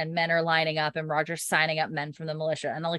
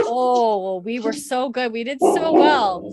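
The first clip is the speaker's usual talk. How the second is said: louder, higher cooing to a dog; pet talk; 4 semitones higher, 7 dB louder, slower than usual. Real, not acted. Host to her dog Zozo after barking, fussing pet talk. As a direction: gush at the dog, drawing words out.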